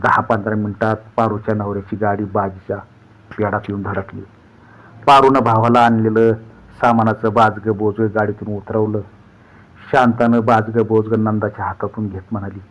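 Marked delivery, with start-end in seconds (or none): none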